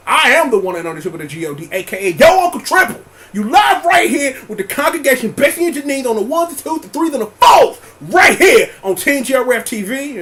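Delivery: loud hyped announcer shoutout; rising to a big shout; hype announcer. Acted. Hyped show outro, name shoutout.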